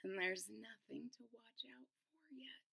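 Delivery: soft unvoiced aside; whisper. A narrator's reading. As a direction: whisper it to the listeners.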